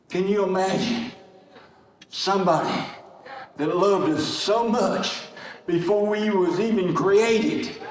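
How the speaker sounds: slow, spaced words with long pauses between; slowed right down. Shown as a demonstration. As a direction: let each phrase land slowly. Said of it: "let me just slow down here".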